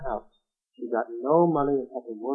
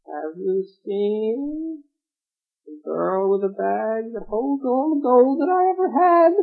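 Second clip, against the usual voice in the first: witch voice; 7 semitones higher, much slower than usual. A narrator's reading. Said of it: "the witch came down and said".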